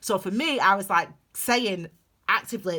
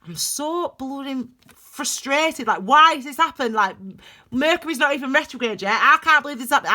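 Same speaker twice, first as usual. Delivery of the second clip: exasperated complaint, higher and often louder; frustrated venting; 5 semitones higher, 5 dB louder than usual. Retold or imitated. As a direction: act out venting your frustration aloud. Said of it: "out of my mouth, I'm saying".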